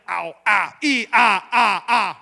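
loud mock yelps of pain; son yelping in pain. Pastor acts his son hurt by thorns, loud mock yelping. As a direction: yelp like a kid stabbed by thorns. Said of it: "my son be like".

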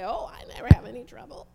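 fast dismissive whisper; a quick breathy put-on whisper; mock denial. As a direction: whisper the lie as if brushing it off. Mocking people who deny trouble.

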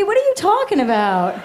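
ordinary delivery

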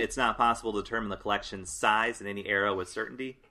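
ordinary talk

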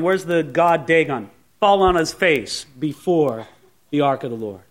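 usual speaking voice

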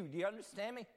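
normal talking voice

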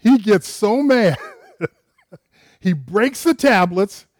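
loud, slow and laughing through the words; big laughing retelling of Moses' fury. Real, not acted. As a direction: tell it loud, cracking up at Moses' temper.

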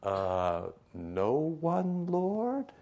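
high, hesitant little voice; timid unsure woman. Retold or imitated. Woman unsure before Jesus, high and hesitant. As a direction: squeak it out, barely daring to ask. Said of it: "she's saying it like this".

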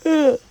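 a loud yawn. Real, not acted. Late-night yawning on air.

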